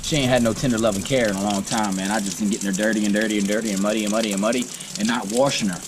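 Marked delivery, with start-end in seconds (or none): none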